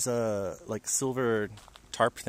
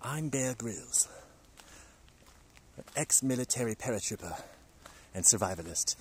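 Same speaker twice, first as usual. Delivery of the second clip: Bear Grylls impression. Shown as a demonstration. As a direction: introduce yourself like a rugged survival host. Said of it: "wispy, raspy voice a little bit".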